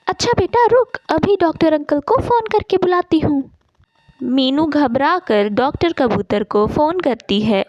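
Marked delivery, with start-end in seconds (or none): 0.1-3.4 high, hurried motherly reassurance; quick, high-pitched anxious mum; worried mother. Acted. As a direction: rush to calm your child. Worried mother hurries to call doctor.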